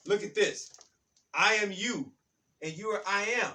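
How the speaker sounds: loud, high, driving preacher's cadence, pounding the key words; full-force preaching. Acted. Preacher rousing his audience about I am, in a loud, high preaching voice. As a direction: preach it out to the crowd, rising and insistent.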